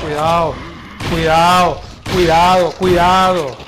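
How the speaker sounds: loud repeated warning yell; shouting. Real, not acted. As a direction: bark the warnings, loud and urgent. Warning during gameplay. (gritando aviso)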